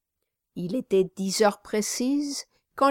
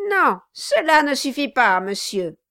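sneering laugh-voice; 4 semitones higher, 5 dB louder than usual. Acted. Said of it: "dit Quilp en ricanant".

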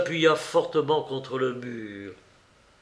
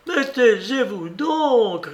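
higher, slow, panicked cry; 9 semitones higher, 4 dB louder, slower than usual. A narrator's reading. Maid crying out as her mistress accuses her, panicked. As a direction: beg in terror, slowly. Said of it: "cria Monique affolée".

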